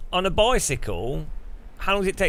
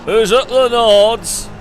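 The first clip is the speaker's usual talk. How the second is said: slow loud call, pitched up; 7 semitones higher, 7 dB louder, slower than usual. Pretend. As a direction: call it out across the room. Calling out to racer.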